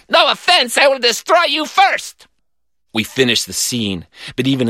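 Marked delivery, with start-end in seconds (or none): 0.1-2.3 high, theatrical vow; hammy villain. A narrator's reading. Villain vowing to destroy his rival, high declaiming.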